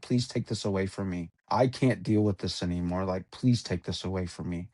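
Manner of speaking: low, hushed imitation of pleading; retold prayer. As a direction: retell a desperate prayer softly and low. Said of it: "I prayed to Christ".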